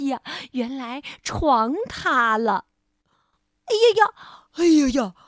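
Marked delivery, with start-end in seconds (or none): 3.6-5.1 high, loud cry of alarm; shouting in alarm. Acted. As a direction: yell it as the bed collapses. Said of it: "一起喊了起来".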